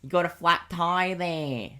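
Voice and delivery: high, slow Aussie drawl; hammed-up Australian bloke, drawn out; broad Australian accent. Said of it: "most Australian guy you could ever meet".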